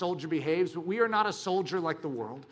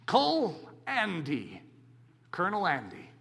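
ordinary talk, nothing marked

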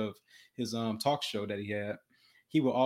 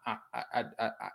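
quiet stuck stutter on I; imitating a boy frozen in a stutter; 5 dB quieter, much faster than usual. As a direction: stammer and get stuck, no words coming. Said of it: "he stutters so much he can't even speak".

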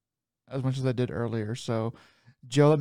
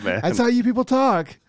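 high, loud mock Yooper accent; 9 semitones higher, 5 dB louder than usual. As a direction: tease them in a Midwest Yooper accent. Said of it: "trying to go for a Uber accent".

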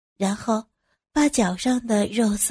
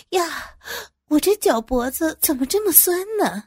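higher-pitched complaint about a sore ankle; pained exclamation; 8 semitones higher than usual. Acted. Boss complains of a sore ankle, pained exclamation. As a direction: moan mildly about an aching ankle. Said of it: "王总呦了一声".

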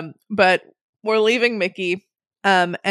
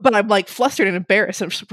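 ordinary delivery

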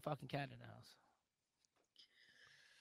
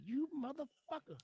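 high, a hint of an outraged tiger; mock tiger; 13 semitones higher than usual. Tiger voiced cursing at the man. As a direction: spit it like an angry cat. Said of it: "that tiger's like".

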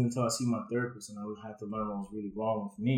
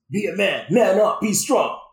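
loud, higher, hard-hitting orders; forceful retold command; 7 semitones higher, 12 dB louder, slower than usual. Father mimics what he taught his son, shouting. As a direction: bark out each order like a drill.

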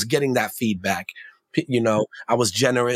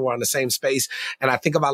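usual speaking voice

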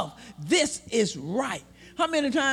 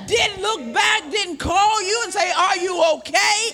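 slow impassioned hollering sermon; preaching at full force; 4 semitones higher, slower than usual. Real, not acted. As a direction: drag it out and shout it. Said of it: "preaching climax".

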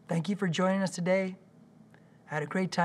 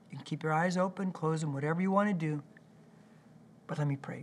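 ordinary delivery

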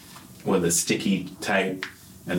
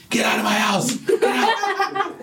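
a husband yelling into a dark house; shouting. Retold or imitated. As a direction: yell it at an unseen intruder. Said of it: "I've always just shouted first".

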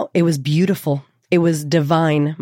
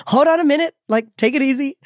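imitated cautioning midwives; 9 semitones higher, faster than usual. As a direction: rein someone in, pitched well up. Midwives urgently telling her to slow.

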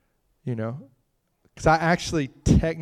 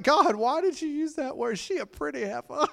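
amused disbelief; 11 semitones higher, 3 dB quieter than usual. Retold or imitated. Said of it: "And I was like".

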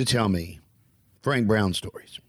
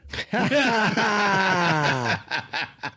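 big laugh; 9 semitones higher than usual. Real, not acted.

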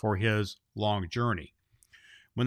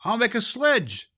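high, louder eager exclamation; exclaiming; 9 semitones higher, 4 dB louder than usual. A narrator's reading. Claus seizes on the sledge idea, high excited exclaim. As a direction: burst out with the new idea. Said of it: "exclaimed Claus".

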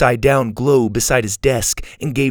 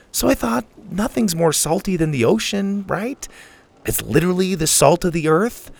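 put-on young Susie, uneasy; 5 semitones higher than usual. Susie explaining herself to Sawyer about the salt, uneasy and high. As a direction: justify yourself awkwardly in a higher voice.

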